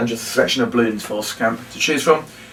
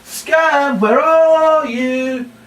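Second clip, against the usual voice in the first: calling out; 13 semitones higher, much slower than usual. Shown as a demonstration. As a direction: shout his name as if he's hiding far off. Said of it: "shouting for Scamp again".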